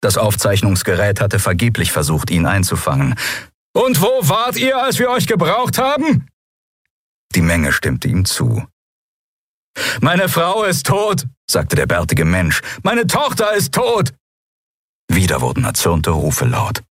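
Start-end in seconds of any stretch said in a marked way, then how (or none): 3.7-6.3 high, strained heckle; angry accusing shout. A narrator's reading. Citizen accuses the absent guards, shouting angrily. Crowd member accuses captain. 10.0-11.3 high, slow, bitter cry; anguished outcry. A narrator's reading. Bearded man tells captain of his dead wife, anguished. 12.8-14.1 very high, bitter cry; anguished outcry. A narrator's reading. Bearded man tells captain of his dead daughter, anguished.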